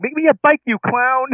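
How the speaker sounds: high squeaky put-on voice; high character voice. Quoting a movie character.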